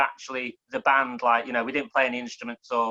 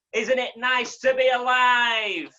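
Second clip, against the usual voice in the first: poem's closing line; 10 semitones higher, much slower than usual. Acted. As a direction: stretch the last line out to land it. Closing refrain, drawn out.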